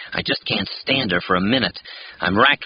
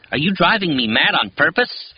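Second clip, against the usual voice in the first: blurted out; 8 semitones higher than usual. A narrator's reading. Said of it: "I finally blurt".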